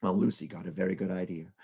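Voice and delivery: plain reading voice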